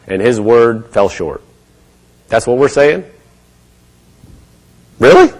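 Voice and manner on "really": squeaky high, loud disbelief; incredulous. Real, not acted. Preacher mocks the idea God's word fails, high incredulous.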